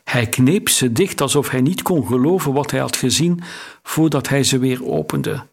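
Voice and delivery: ordinary delivery, nothing marked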